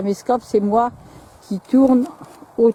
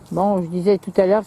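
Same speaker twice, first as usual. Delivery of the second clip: normal talking voice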